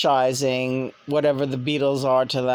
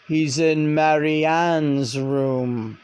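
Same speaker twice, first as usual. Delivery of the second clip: slow, lightly deepened channeled voice; a hint of a deep male voice, drawn out; John's deep voice; much slower than usual. Channeled spirit answers her in his deep voice, lightly done. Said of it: "so clearly said in his deep voice".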